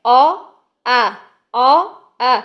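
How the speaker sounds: a full, open drawn آ; heavy aa sound. Shown as a demonstration. Teacher models the heavy vowel آ for pupils. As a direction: hold the heavy آ open and round. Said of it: "ایک کیا ہے؟".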